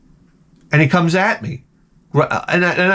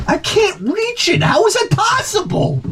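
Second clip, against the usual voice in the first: high-pitched squeal of disbelief; baffled frustration. Acted. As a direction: strain upward in comic bewilderment. Acts out failing to reach something.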